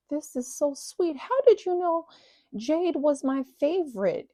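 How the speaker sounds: high-pitched, gushing Texas belle; Southern belle drawl. Retold or imitated. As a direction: coo thanks like a Southern mother-in-law. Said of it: "she's got this long, drawn-out accent".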